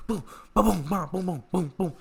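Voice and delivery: high mock volley of booms; comic boom-ba-boom hits; imitated punch noises. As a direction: sound out a flurry of punches.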